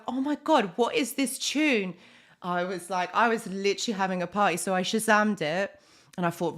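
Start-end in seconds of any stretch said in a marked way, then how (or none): 0.1-1.9 higher-pitched, gushing mock exclamation; retold excited amazement. Host recalls hearing a song in the car, excited amazement. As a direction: re-enact being thrilled by a new discovery.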